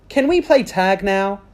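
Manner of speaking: put-on a little girl keen to play; high eager girl voice. Amelia asks brightly.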